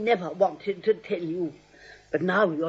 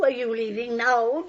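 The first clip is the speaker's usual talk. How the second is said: ordinary talk, nothing marked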